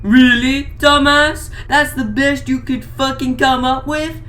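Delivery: mocking sneer. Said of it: "said Mac tonight".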